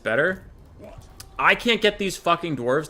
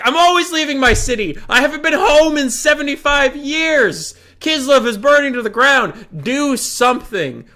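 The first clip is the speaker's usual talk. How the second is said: louder, pleading frustration; louder fed-up commander scolding his allies; exasperated plea; 10 semitones higher, 6 dB louder than usual. Retold or imitated. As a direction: demand action from lazy allies. Himself retold, exasperated.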